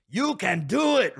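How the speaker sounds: hammed-up Water Boy movie accent. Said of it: "The, the accent".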